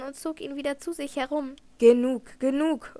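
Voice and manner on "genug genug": loud, burst-out protest; sharp interruption. A narrator's reading. Raoul interrupts Christine's account, a loud outburst. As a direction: cut her off forcefully. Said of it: "unterbrach Raoul sie".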